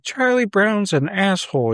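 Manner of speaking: high mock child whine, fairly loud; bratty kid. Kid to dad about the cartoon, bratty. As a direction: imitate a boy talking back.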